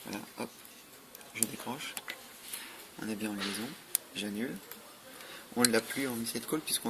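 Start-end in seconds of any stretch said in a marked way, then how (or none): none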